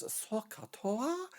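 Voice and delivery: choppy, badly imitated Japanese accent; mock Japanese accent. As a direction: put on a rough Japanese accent. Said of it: "when I'm ruining your accent".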